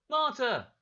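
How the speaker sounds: high, loud shout of the name. Acted. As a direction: call out as he spots her. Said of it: "he shouted".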